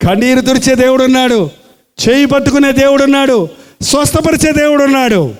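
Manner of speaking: preaching climax. Acted. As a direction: hammer each line home.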